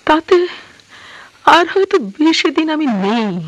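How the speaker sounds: anguished lament. Acted. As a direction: voice rising in distress. Queen laments her failing health.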